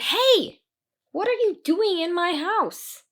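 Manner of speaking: high, loud indignant challenge; angry goblin. Acted. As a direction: catch an intruder. Angry goblin confronts intruder.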